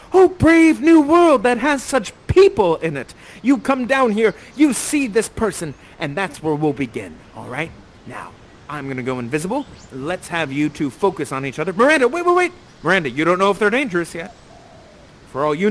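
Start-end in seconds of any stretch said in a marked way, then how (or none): 0.1-3.1 high, loud cry of wonder; awed exclamation. Acted. Director feeds Miranda her amazing line, awed exclamation. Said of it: "You have an amazing line". 11.7-12.5 higher, quick call to stop; urgent interruption. Acted. Director halts Miranda before she goes on, urgent interruption.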